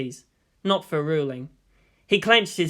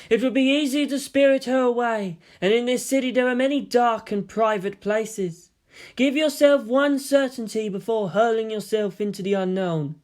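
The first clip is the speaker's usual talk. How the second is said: put-on higher, coaxing girlish voice; tempting girl voice; 6 semitones higher than usual. The figment girl tempting him to spirit Kirishima away, higher and coaxing. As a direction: purr the offer like a tempter.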